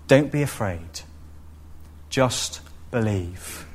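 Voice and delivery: slow, soft, gentle promise; softly and slowly laying down the comfort; tender reassurance. Retold or imitated. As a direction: lean in and reassure quietly. Jesus's words repeated, tenderly.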